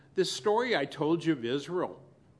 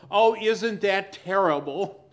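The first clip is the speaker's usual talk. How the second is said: loud, higher mock-shocked gasp; mock outrage; 4 semitones higher, 8 dB louder than usual. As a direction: play the self-righteous onlooker. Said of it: "And then we go".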